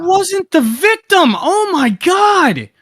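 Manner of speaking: real exasperation. Real, not acted. Accused man protesting that nobody listens, exasperated. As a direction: stretch the words in frustrated disbelief.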